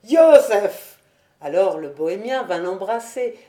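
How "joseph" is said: appel lancé très fort, plus aigu; s'écrier pour accueillir un vieil ami; cri de joie. A narrator's reading. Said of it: "en s'écriant". (exclamation forte)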